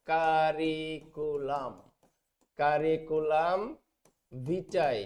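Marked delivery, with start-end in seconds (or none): 0.1-3.6 কারিকুলাম drawn out syllable by syllable, slowly; slow teaching pronunciation. Shown as a demonstration. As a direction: spell out কারিকুলাম slowly for the class. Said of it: "কারিকুলাম ভিটাই এর সংক্ষিপ্ত form".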